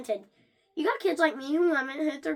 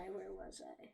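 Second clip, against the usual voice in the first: muttered to self; 7 semitones lower, 21 dB quieter, slower than usual. Real, not acted.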